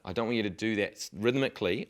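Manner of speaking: normal talking voice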